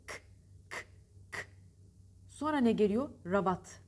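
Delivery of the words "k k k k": K, a bare k sound with no vowel; isolated k sound. Shown as a demonstration. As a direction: click out a voiceless k on its own. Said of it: "Nasıl bir ses?".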